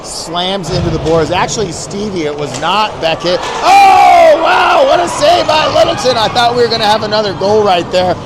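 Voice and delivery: ordinary delivery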